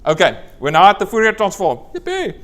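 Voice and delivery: big announcement topped by a very high whoop; playful cheer. Pretend. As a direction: celebrate the new topic with mock glee. Lecturer mock-celebrates reaching the topic.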